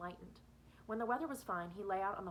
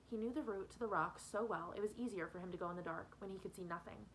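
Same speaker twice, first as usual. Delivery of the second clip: plain reading voice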